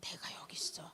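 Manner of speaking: whispered, soft divine assurance; God's whispered presence; hushed voice of God. Acted. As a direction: breathe it like God speaking close. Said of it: "하나님의 음성으로 들으세요".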